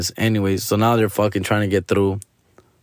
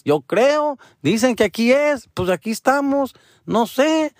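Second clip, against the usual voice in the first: much higher, hesitant mom voice; imitating unsure mom; 13 semitones higher than usual. Son mimics mom unsure they're in the right place, much higher. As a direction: squeak out mom's unsure answers.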